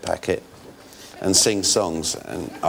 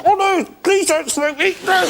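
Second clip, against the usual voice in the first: very high squeaky pleading voice; tiny box voice pleading. Acted. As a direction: beg not to be smoked, tiny and scared. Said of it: "a character who used to talk from inside a box".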